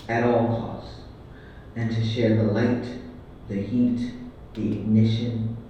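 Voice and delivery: loud, deliberate poetry performance; measured, declaiming poem recital; spoken-word poem. Acted. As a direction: proclaim each line like a stage poet.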